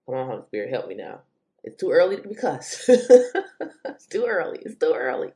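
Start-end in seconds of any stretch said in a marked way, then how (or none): 2.9-3.8 loud high exasperated laugh; burst of laughter. Real, not acted. Laughs at her own frustration.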